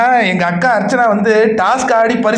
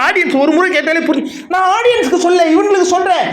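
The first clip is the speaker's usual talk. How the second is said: ordinary delivery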